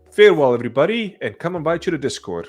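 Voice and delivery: ordinary talk, nothing marked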